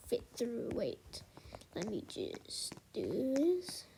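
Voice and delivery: hushed slow whisper to self. Real, not acted. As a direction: breathe it under the breath. Squeezing stretcher through door.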